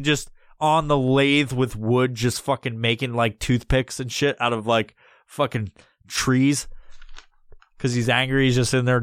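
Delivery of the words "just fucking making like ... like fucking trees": normal talking voice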